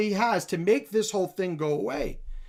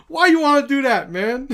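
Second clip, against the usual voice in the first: loud, high mock complaint; Galvao's mock protest; 6 semitones higher, 7 dB louder than usual. Commentator mocking Galvao's reaction to the threat, loud high protest. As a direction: blurt it as an aggrieved protest, pitched up. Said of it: "Galvao's reaction is".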